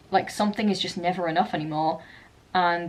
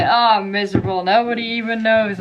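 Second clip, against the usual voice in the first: louder mock-miserable lament; raw misery; 3 semitones higher, 6 dB louder than usual. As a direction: wail it like the raw song ending. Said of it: "it's so raw at the end".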